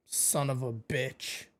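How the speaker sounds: ordinary talk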